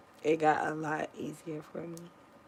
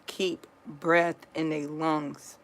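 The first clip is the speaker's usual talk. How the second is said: ordinary talk, nothing marked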